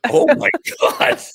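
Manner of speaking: high, loud retold outcry broken by laughter; laughing disbelief. Lawyer reacting to judge's prank, laughing disbelief. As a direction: exclaim it in mock shock, cracking up.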